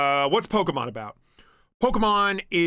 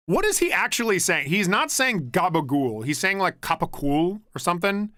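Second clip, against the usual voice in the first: ordinary talk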